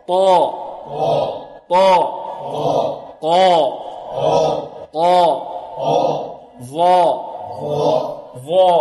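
heavy, full emphatic letters without rounding the lips; heavy letter drill. Shown as a demonstration. Teacher drilling students on the seven heavy letters, emphatic. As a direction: say each heavy letter alone, lips unrounded. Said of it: "انتقوا معي بدون الاعتماد على الشفتين".

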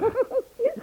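high bright giggle at a compliment; flattered laugh. Acted. Catherine laughs at Robert's flattery, high giggle.

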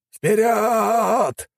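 high shouted battle cry; cover me shout. A narrator's reading. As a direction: scream the charge over gunfire. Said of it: "кричал командир". (боевой крик)

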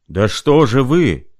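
loud, slow, high thunder. Acted. Mark Ivanovich thundering at the sick man, loud and slow. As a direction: roar the question. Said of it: "прогремел".

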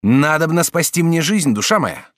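urgent, pressing plea. A narrator's reading. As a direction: beg her to save his life. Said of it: "сказал Жак Обри".

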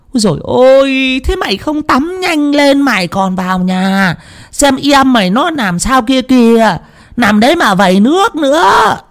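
put-on high, nagging old woman's voice; old woman scolding a boy at the well. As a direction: nag him like a village granny.

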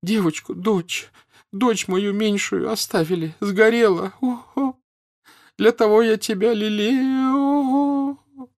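high, keening lament with drawn-out moans; sobbing wail.

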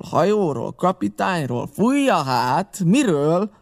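high, mocking questions; insistent, sing-out prodding; badgering interrogation. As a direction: needle him, listing options one by one. Silver grills and mocks Morgan.

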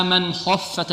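slow "من خفت" without bouncing the kha; careful recitation. Shown as a demonstration. Teacher repeats the correct form. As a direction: recite "من خفت" evenly and slowly. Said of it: "ولا نقلقل".

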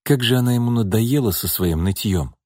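plain reading voice

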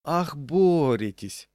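drawn-out, high mock amazement; sarcastic exclamation. A narrator's reading. Diner mocks the manageress's sanitary struggle, sarcastic exclamation. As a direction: stretch it out with loud sarcasm. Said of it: "воскликнул я".

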